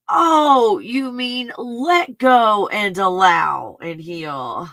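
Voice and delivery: high, loud oh, then a slow dawning realisation; imitating an amazed student. Host mimics students realising on day twenty-three, slow and amazed. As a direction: play the penny dropping, slowly. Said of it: "they're like".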